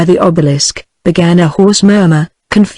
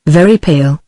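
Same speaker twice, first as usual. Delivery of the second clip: ordinary delivery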